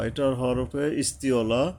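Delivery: ordinary talk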